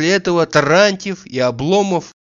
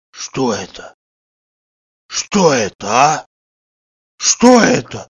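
choked, gasping shock; slower than usual. A narrator's reading. Slapped man holding his cheek, stunned breathless voice. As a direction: gasp it out in stunned pauses. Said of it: "задыхаясь".

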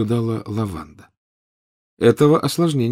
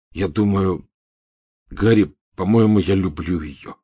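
choked voice. Acted. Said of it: "сдавленным голосом ответил Рон".

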